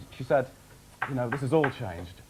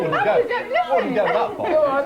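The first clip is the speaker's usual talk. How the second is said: laughing protest; 5 dB louder, much slower than usual. Real, not acted. Protesting the drunk accusation.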